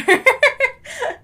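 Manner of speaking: high, loud burst of laughter; high giggling outburst; real laugh. Real, not acted. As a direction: crack up laughing. Cracks up at the narrator.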